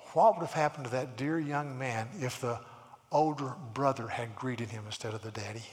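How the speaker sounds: ordinary delivery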